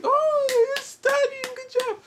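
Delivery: very high, slow, sing-song praise; exaggerated sweet grown-up praise; praising adult. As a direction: coo it like a teacher praising a toddler. Adults praising him at school.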